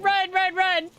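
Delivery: high panicked urging; terrified horror victim. Real, not acted. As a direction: keep urging them to run. Still fleeing monsters.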